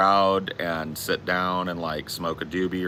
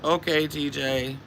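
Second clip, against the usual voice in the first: retold wary okay; 7 semitones higher, slower than usual. Taken aback by friend's abuse.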